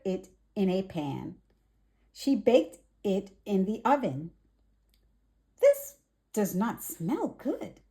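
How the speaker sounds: lively storybook read-aloud; gentle children's story narration. A narrator's reading. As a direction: read it bright and clear for little ones. Reads picture book to children.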